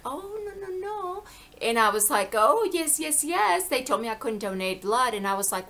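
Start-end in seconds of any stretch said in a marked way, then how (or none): 0.0-1.2 high-pitched imitation of mother refusing; mom's denial. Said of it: "she was like". 2.4-3.7 high, louder insistent yeses; insistent retold comeback. Retold comeback to mom's denial.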